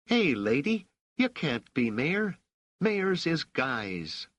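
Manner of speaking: put-on high-pitched, cheeky kid with rough street talk; drawn-out, brash boy's protest; raucous street boy. A narrator's reading. Raich scoffs at a lady mayor, raucous boy's voice. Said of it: "he laughed raucously and said".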